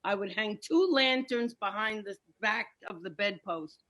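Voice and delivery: normal talking voice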